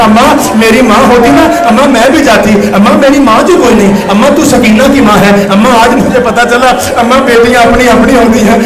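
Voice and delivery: loud dramatized wail, each plea cried out; weeping lament. Retold or imitated. Daughter who has no mother of her own, imitated loud weeping. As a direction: wail like a daughter left behind. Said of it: "رو رو کے کہتی".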